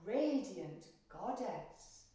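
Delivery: ordinary delivery, nothing marked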